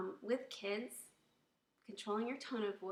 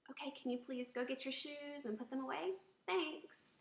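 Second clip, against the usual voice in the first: higher, friendly polite request; calm and positive; 5 semitones higher than usual. Shown as a demonstration. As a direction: ask the kids sweetly and patiently. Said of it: "keeping it calm".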